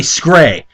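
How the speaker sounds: "scray" said as one syllable, skray, no middle vowel; mispronounced scary. Shown as a demonstration. Reading a misspelled fanfic word aloud, "scray" said skray. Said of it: "That's a new way to say scary".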